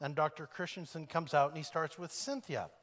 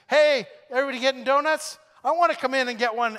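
loud, higher eager call from the doorway; 9 semitones higher, 14 dB louder, faster than usual. Retold or imitated. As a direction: shout in cheerfully from the hall. Newcomer calling into the room.

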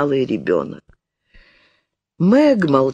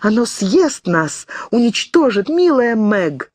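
trembling terror; 8 semitones higher than usual. A narrator's reading. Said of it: "вся трепещущая, прижимаясь к сестре".

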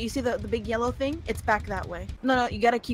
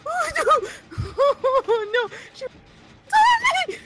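much higher, shouted oh no through laughter; panicked cries; 6 dB louder, much slower than usual. Real, not acted. Player chased by the pig, panicked high shouts. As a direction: squeal as the killer catches up.

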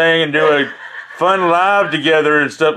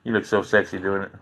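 flirty sweet talk; 9 semitones lower, 6 dB quieter than usual. Real, not acted. Sweet-talking his wife on stream.

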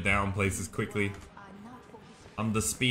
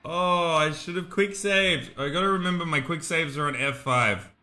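ordinary talk

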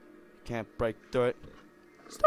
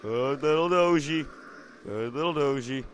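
usual speaking voice

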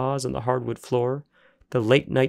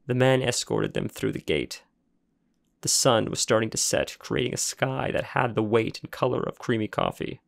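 usual speaking voice

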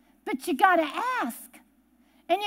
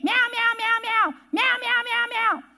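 higher, relentless imitated meows; meowing cat; 4 semitones higher than usual. Cat following owner into living room, high imitated meowing. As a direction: meow nonstop like a cat demanding food. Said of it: "she would meow after you".

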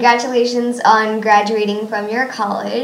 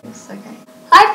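ordinary delivery

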